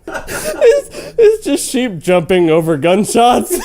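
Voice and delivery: loud, high, crying laughter through the words; real helpless laughter; laughing loudly. Real, not acted. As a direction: wheeze out the joke mid-laugh. Laughing at her own joke.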